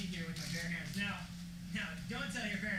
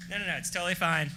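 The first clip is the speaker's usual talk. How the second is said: normal talking voice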